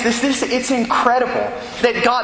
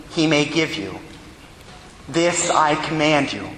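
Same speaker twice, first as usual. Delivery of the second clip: solemn Gospel reading; 4 semitones lower than usual. Acted. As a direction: proclaim the Gospel steadily and reverently.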